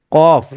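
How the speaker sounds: কফ with a deep back-of-throat ক; Arabic qaf. Shown as a demonstration. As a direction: stress the throaty ক in কফ. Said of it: "সঠিক আরবিতে এর উচ্চারণ কি হয়?".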